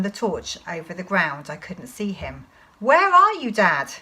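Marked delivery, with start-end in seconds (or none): 2.8-3.9 high, loud searching question; calling out. Acted. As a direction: shout high, dropping on the last word. Child calls for unseen father.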